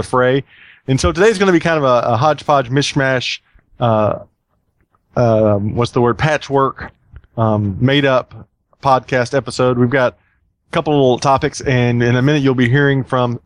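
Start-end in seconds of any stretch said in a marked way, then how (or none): none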